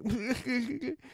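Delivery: high-pitched imitation of laughing while crying; giddy crying. Showing how the Twinkie scene hits them, mock giddy crying. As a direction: mimic a squeaky, giddy cry-laugh. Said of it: "I'm giddy and crying".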